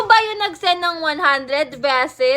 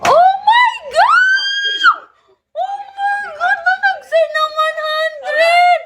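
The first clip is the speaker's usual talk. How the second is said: very high, drawn-out excited scream; screaming in shock; 12 semitones higher, slower than usual. Real, not acted. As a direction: squeal it out, stretching the words in disbelief. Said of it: "Pinasigaw mo ako ng ganon-ganon". (gulat na gulat na tili)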